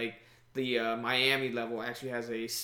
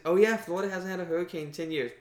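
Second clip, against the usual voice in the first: quick, higher-pitched mocking quote; imitating common chatter; 5 semitones higher, 3 dB louder, faster than usual. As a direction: parrot what everybody keeps saying. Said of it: "Everybody keeps on mention".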